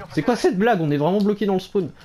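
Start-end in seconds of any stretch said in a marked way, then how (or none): 0.1-0.8 raised, exasperated repeat; indignant disbelief. Real, not acted. Repeats complaint, second louder.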